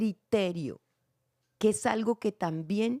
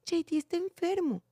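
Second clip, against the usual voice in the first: "J D está enfermo" said high and mournful, as over a sick pet; sad; 9 semitones higher than usual. Shown as a demonstration. Coaching a child actor about the sick dog, said sad. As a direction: let the sadness for the dog into it. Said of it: "Ok, dilo triste".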